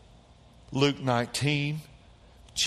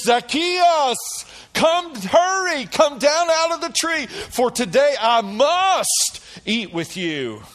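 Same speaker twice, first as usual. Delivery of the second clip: high, loud shout up to someone in a tree; booming summons, pitched way up; calling out; 12 semitones higher, 6 dB louder, slower than usual. Acted. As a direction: call out urgently, stretching the key words.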